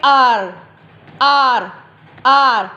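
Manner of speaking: आर said as one long ar; आर repeated clearly and steadily; blended sound. Shown as a demonstration. Teacher shows how to say आर, clear and steady. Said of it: "उसको कैसे pronounce करेंगे".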